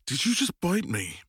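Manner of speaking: high, startled disbelief; indignant surprise; shocked question. Acted. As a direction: ask it still stinging from the bite. Reacts to being bitten.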